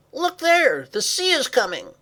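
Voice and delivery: high, loud shouted alarm; alarmed cry. Acted. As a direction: shout a warning of looming danger. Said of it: "Mahala cried".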